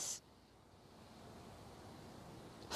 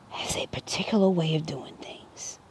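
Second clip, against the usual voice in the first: hushed whisper; whispered with reverence; 3 semitones lower, 10 dB quieter than usual. Real, not acted. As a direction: drop to a secret whisper. Solemn aside about God.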